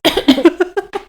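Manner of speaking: coughing fit; sharp choking coughs on tea; choking on a drink. Real, not acted. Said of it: "Je m'étouffe avec mon thé".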